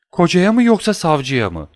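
pleading question. Acted. Wife asks husband or prosecutor.

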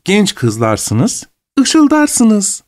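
"ışıldarsınız" lifted high to match "kızlarsınız"; rhyme shown. Shown as a demonstration. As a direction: spell out the rhyme for father. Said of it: "İkisinin de sonları kafiyeli".

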